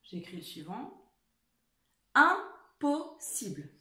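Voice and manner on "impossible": Impossible drawn out slowly, each syllable clear; slow dictation. Shown as a demonstration. Teacher dictating Impossible slowly to her class. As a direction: stretch Impossible for the pupils. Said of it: "écrivez-le celui-ci".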